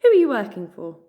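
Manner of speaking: stern hedgehog confronting strangers; scowling demand. A narrator's reading. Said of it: "the hedgehog scowled".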